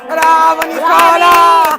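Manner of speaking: loud, slow, drawn-out praise. Real, not acted. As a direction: call the praise out across the field. Praising a player.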